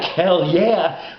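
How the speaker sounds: a loud, high, pumped-up hell yeah; excited cheer. Retold or imitated. Fan recalls cheering at news of Bushwhackers, excited and loud. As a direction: cheer it like a fan who can't wait. Hearing the Bushwhackers were coming.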